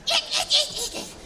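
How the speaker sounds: high, laughing "eat, eat"; frantic eat command. Retold or imitated. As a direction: push the child to eat fast. Frantic parent in the car.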